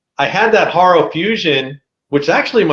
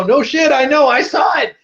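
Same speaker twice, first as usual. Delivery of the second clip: high, retold shouted retort; reenacted frustrated holler; exasperated yell back; 11 semitones higher than usual. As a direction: fire it back at them, pitched up. Yelling back at drivers, retold.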